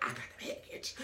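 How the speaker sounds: whispered aside. Retold or imitated. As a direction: breathe it out secretly.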